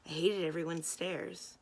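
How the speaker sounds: ordinary delivery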